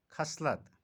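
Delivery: ordinary delivery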